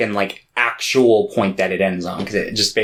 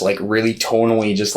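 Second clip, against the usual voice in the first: ordinary talk, nothing marked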